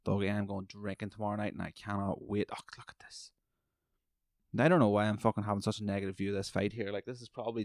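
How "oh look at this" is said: hushed breathy aside; whisper. Real, not acted. Fan watching the fight murmurs to himself, whisper. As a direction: whisper it as if not to disturb.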